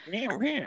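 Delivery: high, soft mock bleats; high soft meh meh; mock whiny bleat. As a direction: bleat like a scared skier. Imitating herself skiing moguls.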